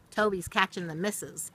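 usual speaking voice

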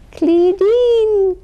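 hammed-up sugary ogress voice; fake sweet voice. A narrator's reading. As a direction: overdo the sweetness like a disguised ogress. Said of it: "making her voice very sweet".

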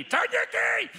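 hammed-up demand from the wife; mock insistent shout. Wife pushing him to turn the key, mock high insistent shout. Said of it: "She'll turn her key".

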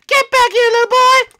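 very high, loud falsetto yell; shrieking store lady. Acted. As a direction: screech after the runaway boy. Store lady chases fleeing boy.

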